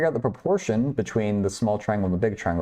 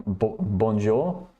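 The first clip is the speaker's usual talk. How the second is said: hammed-up French bonjour; a mock Parisian greeting, lightly done; playful put-on French accent; fake French accent; much slower than usual. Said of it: "My fake French accent".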